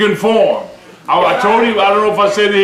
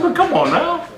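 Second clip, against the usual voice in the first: high, teasing "come on now" with a laugh; teasing plea; 8 semitones higher, 3 dB quieter than usual. Retold or imitated. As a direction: coax him playfully. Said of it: "I said".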